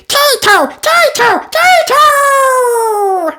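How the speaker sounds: put-on very high excited toddler shout; baby George cheering. A narrator's reading. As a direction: squeal it like a tiny boy. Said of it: "cheered George".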